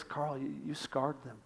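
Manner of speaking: hushed reproof; gentle inner voice. Acted. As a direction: convict gently and quietly. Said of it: "And I hear that voice".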